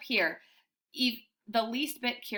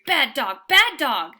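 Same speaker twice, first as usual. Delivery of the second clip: loud angry scolding; yelling; 3 semitones higher, 8 dB louder, slower than usual. A narrator's reading. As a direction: scold the dog loudly. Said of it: "she yells".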